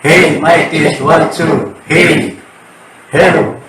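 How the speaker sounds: chorus effect alien voice; like an alien over the mic. Shown as a demonstration. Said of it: "Para akong si alien".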